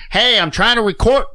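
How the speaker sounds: high, loud mock outburst; imitating an irritated trainer shouting; pissed-off yell. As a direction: holler at passing trucks, annoyed. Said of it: "he'd get all pissed".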